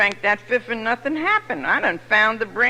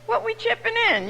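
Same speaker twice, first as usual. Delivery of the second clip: drinking buddies; 12 semitones higher than usual. Retold or imitated. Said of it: "You say".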